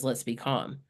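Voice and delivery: calm daughter. Retold or imitated. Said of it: "because she says".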